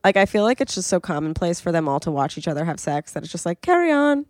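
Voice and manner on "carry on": high, put-on "carry on"; lightly sing-song mock dismissal; mock nonchalant. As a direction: imitate an unbothered passerby, pitched up. Mimics blasé onlooker to couple.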